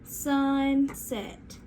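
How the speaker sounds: "Sunset" drawn out as SUN... SET; slow, segmented "Sunset" for the class; stretched into syllables. Shown as a demonstration. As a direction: stretch "Sunset" into two separate parts. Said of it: "say sunset with me".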